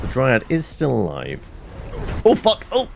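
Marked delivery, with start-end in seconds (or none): 2.2-2.9 sudden high startled curse; real startled cry; startled yelp. Real, not acted. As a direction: jump at the shock. Sudden danger in game.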